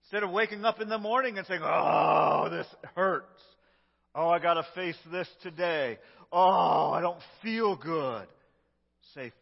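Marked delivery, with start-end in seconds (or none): 1.6-8.3 groaning complaint. Acted. Grumpy morning complaints acted out.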